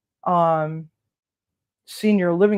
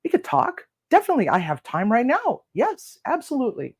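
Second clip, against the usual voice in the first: much higher, eager agreeing; eager, perked up; 8 semitones higher than usual. Retold or imitated. Agreeing to talk with the jail's hiring man, eager and perked up. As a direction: gush yes like you want the job. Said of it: "I was all perked up and was like".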